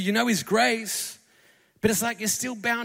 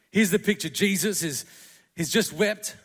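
normal talking voice

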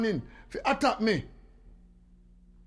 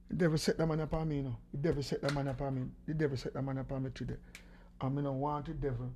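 low, much softer muttering to himself; under-breath grumble; 6 semitones lower, 12 dB quieter than usual. Real, not acted. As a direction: grumble it half to yourself. Muttering to himself about caller.

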